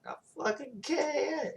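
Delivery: very high, drawn-out mock whine; mock protest. Girl told to control her powers, high mock protest. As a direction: exaggerate a helpless protest in falsetto. Acted girl's protest in retelling.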